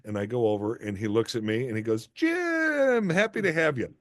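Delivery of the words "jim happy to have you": name held long and high, then a friendly welcome; delighted greeting. Retold or imitated. Director welcomes the nervous actor on set, delighted greeting.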